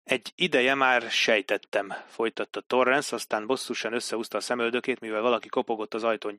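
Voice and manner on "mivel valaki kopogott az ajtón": plain reading voice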